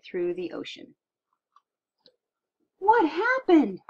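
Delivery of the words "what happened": slow, much higher and louder alarmed cry; frightened crew call out. Acted. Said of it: "cried the crew".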